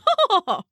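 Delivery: high scornful little laugh; mocking laugh. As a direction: laugh at her, puffed up. Lark laughs at Fan.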